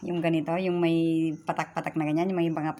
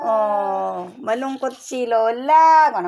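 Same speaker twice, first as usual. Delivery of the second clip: higher, louder, slow cooing; 5 semitones higher, 7 dB louder, slower than usual. Real, not acted. Grandmother coos over her grandkids, slow and high. Baby talk about grandkids.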